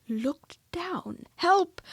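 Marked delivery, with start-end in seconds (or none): 1.4-1.8 high, loud cry for help; frightened cry. A narrator's reading. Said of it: "cried Piglet".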